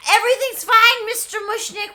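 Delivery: high, loud call from offstage; Seymour from offstage. Retold or imitated. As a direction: pretend all is fine while crashing about. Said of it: "We see Seymour going".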